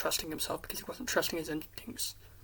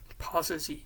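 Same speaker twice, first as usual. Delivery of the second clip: ordinary delivery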